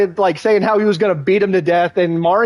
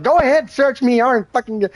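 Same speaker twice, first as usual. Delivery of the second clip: much higher, defeated offer; high resigned junkie voice; 8 semitones higher than usual. Retold or imitated. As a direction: give up, voice pitched up. Craig voiced giving up.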